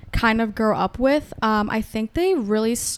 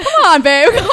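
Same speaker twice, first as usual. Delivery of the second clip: much louder, higher, laughing come on; laughing exasperated plea; 9 semitones higher, 10 dB louder than usual. Retold or imitated. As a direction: beg the boyfriend while cracking up. Mock exasperated plea to boyfriend.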